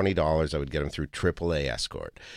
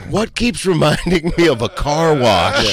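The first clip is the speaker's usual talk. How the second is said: loud; 11 semitones higher, 10 dB louder than usual. Acted. Loud comic aside.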